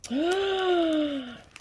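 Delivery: high drawn gasp of delight. Real, not acted. Finding a huge coffee mug, a high delighted gasp. Opening fragile wrapped gift.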